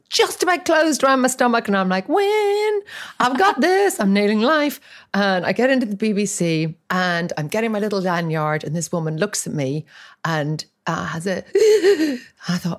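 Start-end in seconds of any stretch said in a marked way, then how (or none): 2.1-4.8 high-pitched gleeful self-congratulation; mock triumph. New mother dressed for an audition, mock triumphant. 11.5-12.2 loud, high mock gasp; shocked gasp. Woman at reception looks at actor, shocked gasp. Retold discovery at the audition.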